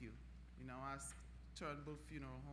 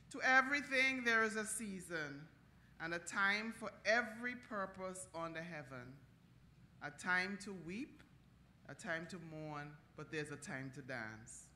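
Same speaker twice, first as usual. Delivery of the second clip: scripture reading; 6 dB louder than usual. Acted. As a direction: read it like a preacher at a funeral.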